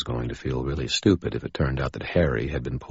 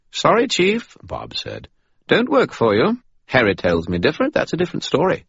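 Bob voice; 12 semitones higher, 6 dB louder than usual. Acted. Bob refuses.

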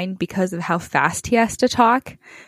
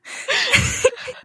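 high giggle. Real, not acted. Laughing at own Parks and Rec story.

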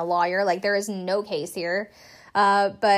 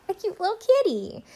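cutesy voice; 11 semitones higher than usual. Pretend. Mock reveal after whisper.

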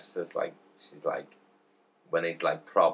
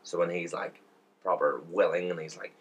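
usual speaking voice

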